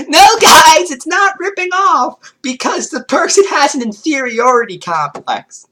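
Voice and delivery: high, loud mocking whine; sarcastic mock excuse. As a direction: parody the excuse, exaggerated. Mocking Joshua's logic.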